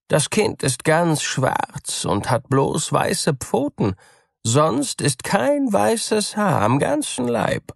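high, wheedling cat voice; sly cat. A narrator's reading. Cat asking the mouse for leave, high and wheedling. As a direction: coax the mouse with a sweet lie.

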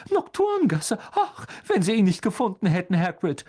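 very high horrified fretting; shrieky motherly dismay, easing off; aghast. A narrator's reading. Mother aghast at Harry's escape.

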